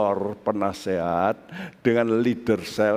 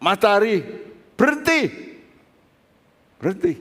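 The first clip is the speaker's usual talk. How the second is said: commanding shout; 9 semitones higher, faster than usual. Retold or imitated. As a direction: call out like Joshua ordering the sun to stop. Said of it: "Yosua berkata".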